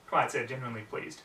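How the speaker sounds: usual speaking voice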